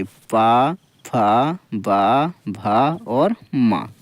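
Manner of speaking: drawn-out lip syllables, one by one; slow labial demo. Shown as a demonstration. Teacher showing lip letters, slow syllable demo. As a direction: slowly sound out पा, फा, बा, भा और मा with the lips. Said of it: "पा वर्ग पूरा हम इससे बोलेंगे".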